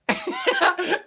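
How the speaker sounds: short high laugh. Real, not acted. After the shooting story, a high laugh. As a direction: laugh brightly.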